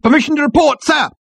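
high, loud parade-ground shout; military bark, pitched right up; eager soldier reporting. A narrator's reading. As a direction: call it out like a keen subordinate. Soldier reporting to his captain.

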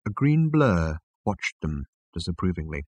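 ordinary delivery, nothing marked

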